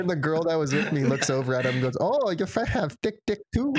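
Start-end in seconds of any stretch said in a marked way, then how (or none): 2.0-3.7 put-on high, lightly accented woman's voice through a laugh; lightly voiced masseuse, amused. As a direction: masseuse teasing about his friend, high and amused.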